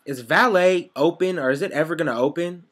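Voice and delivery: high, loud mimic of an upset customer; imitating an impatient guest. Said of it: "she goes".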